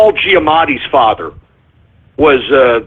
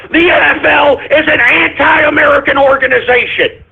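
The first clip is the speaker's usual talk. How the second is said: pitched up, ranting declaration; heated outburst; 6 semitones higher than usual. Real, not acted. Host denounces the NFL to his audience, heated and raised. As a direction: fling the accusation out hot. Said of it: "I'm serious".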